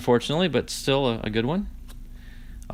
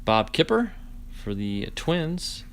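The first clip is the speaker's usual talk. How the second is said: usual speaking voice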